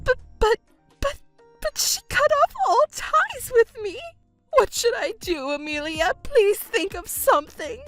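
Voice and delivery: high, tearful pleading through sobs; crying mother. Acted. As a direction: beg her daughter through tears. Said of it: "are you crying, Mother?".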